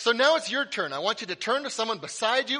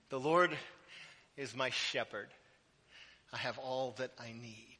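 scripture reading; 6 semitones lower, 9 dB quieter than usual. Acted. Pastor reading Psalm twenty-three softly to the congregation. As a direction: read it hushed and reverent.

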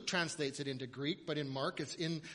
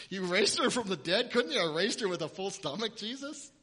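mock indignant parent; 3 semitones higher, 12 dB louder than usual. Parents teasing Jesus about feeding the girl, mock exasperated. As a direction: play the fussing parent who wants her fed.

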